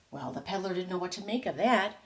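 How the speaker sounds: plain reading voice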